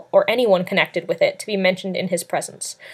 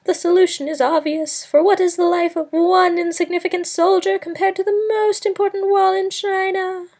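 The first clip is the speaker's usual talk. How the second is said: bellowing soothsayer; 11 semitones higher than usual. A narrator's reading. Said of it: "he bellowed".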